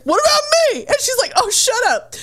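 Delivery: high hammed-up protest; mock yell. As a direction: shout it like a sulky thug. Collector yells, she snaps.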